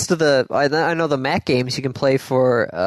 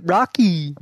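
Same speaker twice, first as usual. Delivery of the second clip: lightly put-on cartoon moose call; cartoon moose impression; Bullwinkle; 4 semitones higher than usual. Shown as a demonstration. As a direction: call out to a sidekick as a goofy moose. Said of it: "then they're Bullwinkle".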